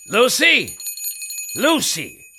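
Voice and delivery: loud, high call for the maid. Acted. Master calling for the maid, loud and high. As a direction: shout for her from downstairs.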